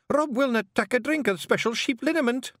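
outraged Scots wife; high alarmed report; urgent. Acted.

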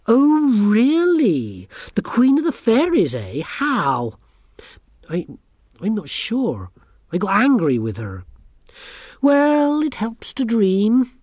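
put-on high, smug and sneering girl; haughty schoolgirl. A narrator's reading. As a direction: look down your nose at everyone. Anagramma mocks Tiffany's claim.

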